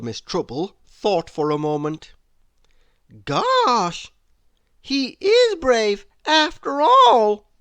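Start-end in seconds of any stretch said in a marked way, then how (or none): 3.2-7.5 high, slow, loud wonder; in awe. A narrator's reading. Miss Trouble admitting to the crowd in high, slow awe. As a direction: marvel at it. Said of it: "she said in awe".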